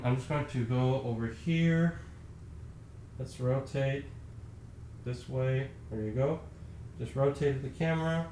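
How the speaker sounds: drifting off mic as he walks; fading out. Real, not acted. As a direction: voice fading, walking away from the mic. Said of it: "You're probably not hearing me very well right now 'cause I'm walking away from the mic".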